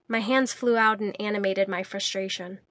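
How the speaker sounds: usual speaking voice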